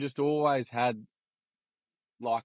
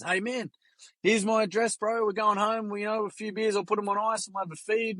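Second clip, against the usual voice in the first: lightly imitated Kiwi accent, pitched higher; Kiwi bloke voice; 9 semitones higher than usual. Kiwi bloke inviting the family over for beers, high imitated Kiwi accent. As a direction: hand over the address like a friendly Kiwi, voice lifted high. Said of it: "He goes".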